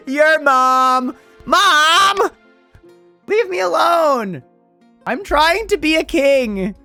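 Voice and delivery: put-on high, slow whining teen; whiny son. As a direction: whine at mom like a sulky teen. Son whines at his mother.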